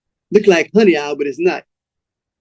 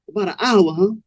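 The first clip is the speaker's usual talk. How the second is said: cool pimp echoing; 3 semitones higher, 3 dB quieter than usual. Acted. Cold Blood checking the young man's answer, cool echo. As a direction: echo it back coolly, sizing him up.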